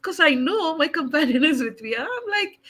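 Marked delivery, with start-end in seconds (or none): none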